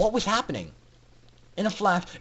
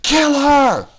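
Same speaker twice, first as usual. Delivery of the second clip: higher, loud murderous scream; furious scream; 8 semitones higher, 9 dB louder than usual. A narrator's reading. Rainbow Dash ordering her cronies, furious scream. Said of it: "she screamed at the other".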